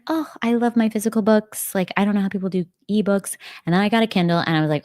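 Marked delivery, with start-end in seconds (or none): 0.0-3.3 higher-pitched gushing, imitating your old self; lightly mock your former book-snob self; gushing past self. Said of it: "I used to be like".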